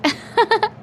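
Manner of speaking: high laugh. Real, not acted. Laugh after the joke.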